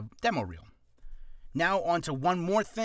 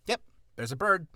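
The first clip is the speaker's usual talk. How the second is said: casual idle mood; 4 semitones higher than usual. Shown as a demonstration. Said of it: "just walking down the street".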